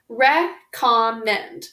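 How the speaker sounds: recommend stretched slowly, syllable by syllable. Shown as a demonstration. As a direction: draw out recommend slowly for spelling. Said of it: "three syllables".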